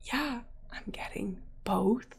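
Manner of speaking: slow, near-whispered confession; hushed, grudging retold admission; reluctant admission. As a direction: let it out quietly and slowly, defeated. Reluctantly admits the spoiled surprise.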